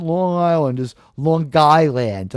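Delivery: slow, drawn-out New York accent Long Island. Shown as a demonstration. Streamer showing chat how New Yorkers say it, New York accent. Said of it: "when they say it with the, with the New York accent".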